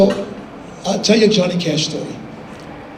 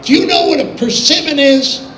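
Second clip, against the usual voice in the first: higher, lightly voiced country host; teasing retold question, pitched up; a hint of a country friend quizzing a city boy; 8 semitones higher, 4 dB louder than usual. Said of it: "And he says".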